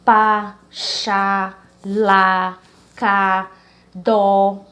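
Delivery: pá, chá, lá, cá, dó, each one loud and separate; strong syllables shown. Shown as a demonstration. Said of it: "é uma sílaba mais forte". (monossílabos tônicos, cada um forte e separado)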